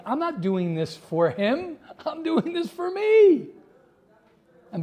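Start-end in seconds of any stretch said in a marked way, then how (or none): 0.1-3.5 slow, rising to high on me; slowly dawning self-realization; inner realization. Retold or imitated. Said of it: "I'm like".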